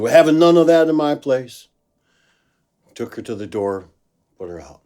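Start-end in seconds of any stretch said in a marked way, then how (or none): 0.0-1.7 loud, higher, laying down the law; firm loud order. Retold or imitated. Sound man tells the angry woman to leave, loud and firm. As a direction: throw her out with a loud firm voice.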